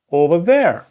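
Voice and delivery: ordinary talk, nothing marked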